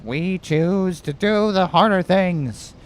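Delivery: high, slow Boston-orator accent, hammed up; Kennedy. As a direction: attempt a presidential New England accent. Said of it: "I can't do the Kennedy accent".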